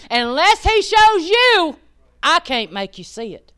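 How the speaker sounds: high and loud, near shouting; full force preaching. Acted. Preaching that the Spirit moved, full force. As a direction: preach it to the rafters.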